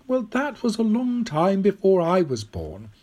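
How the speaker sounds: put-on high old lady's voice; old woman, explaining. Old woman correcting Tom about the dates, high and assured.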